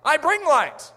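high, eager pitch for attention; counterfeit light-bringer. Acted. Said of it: "He goes".

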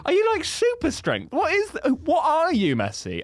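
high-pitched incredulous questioning; astonished fan squeak; amazed disbelief. Acted. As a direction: marvel at him, pitch way up. Acting out an amazed viewer.

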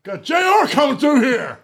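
high, drawn-out hollering impression; screaming down the hallway. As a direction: clear the hallway like overzealous security. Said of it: "he's, like, screaming down the hallway".

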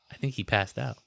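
normal talking voice

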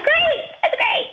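high, slow, laughing sarcasm; sarcastic great. Retold or imitated. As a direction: fake delight through a laugh. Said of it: "I'm like".

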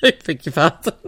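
giggling mock outrage; laughing repeat. Brother-in-law repeating the shocked reply, giggling. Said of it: "And he went".

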